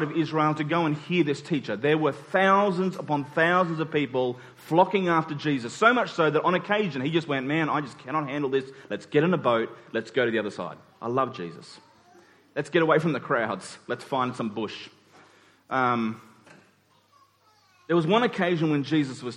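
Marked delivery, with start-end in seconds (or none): none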